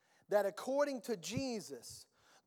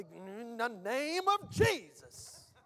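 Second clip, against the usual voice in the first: hammed-up invocation, stretched and swelling; worked-up exorcist; 3 dB louder, much slower than usual. Mimicking someone casting out a demon, worked up. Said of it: "you gotta work yourself up".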